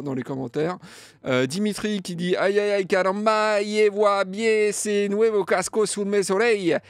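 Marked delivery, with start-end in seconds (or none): 2.4-6.8 cheerful fake Spanish accent read aloud; mock Spanish accent. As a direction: play it up like a cartoon Mexican, in a Spanish accent. Reading a mock-Spanish comment.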